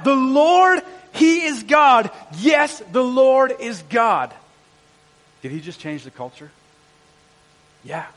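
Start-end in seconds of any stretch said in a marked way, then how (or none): 0.0-4.3 loud, high proclamation of a crowd; crying out. Acted. Awestruck people face down before God, crying out loudly. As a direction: cry it out in awe, drawing out the words. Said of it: "and they cried out".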